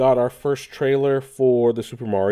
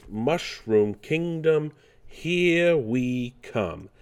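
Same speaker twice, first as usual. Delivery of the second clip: very slow, drawn-out New York Mario; New York inflection; 4 semitones higher, much slower than usual. Retold or imitated. Quoting Mario from the trailer, New York inflection. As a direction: stretch it with a New York lilt. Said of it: "where I get the New York inflection".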